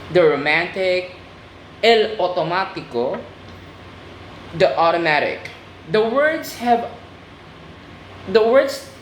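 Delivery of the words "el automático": "automático" in Spanish, ow-toh-MAH-tee-koh; Spanish pronunciation. Shown as a demonstration. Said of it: "just add an O".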